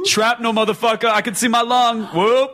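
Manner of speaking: mock wounded cry. Voicing a wounded juggalo.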